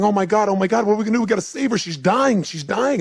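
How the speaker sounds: high, loud, rapid-fire alarm; panicked yelling. Retold or imitated. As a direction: cry out in acted panic for help. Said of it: "all of a sudden they start yelling".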